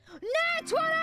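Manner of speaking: high triumphant yell; excited shout. Real, not acted. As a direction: scream with joy at the roll. Celebrating a natural twenty.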